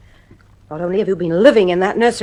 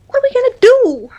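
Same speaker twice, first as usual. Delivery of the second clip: anxious cry; 9 dB louder than usual. Acted. Wife distressed after the nursery.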